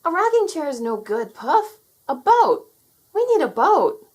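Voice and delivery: put-on a high, loud, urgent child voice; a little boy insisting he needs a boat; impatient young boy. A narrator's reading. Terry corrects Puff's painting, in a high impatient boy voice.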